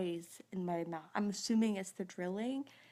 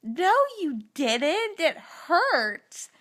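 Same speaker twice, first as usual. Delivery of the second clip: mock protest; 6 semitones higher, 7 dB louder, much slower than usual. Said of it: "I'm like".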